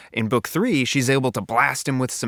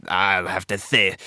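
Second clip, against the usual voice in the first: villain voice; 3 semitones lower than usual. Acted. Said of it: "how would Spar sound?".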